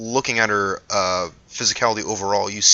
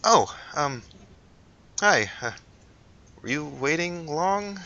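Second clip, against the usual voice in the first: shy Eliza; 7 semitones higher, 3 dB quieter, slower than usual. Acted. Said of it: "She looks over and says".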